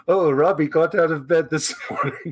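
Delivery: lightly put-on storyteller, laughing at the end; playful narrator voice. Interviewer jokingly narrates the guest's morning, playful mock narrator voice. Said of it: "when the voice changes? Is like".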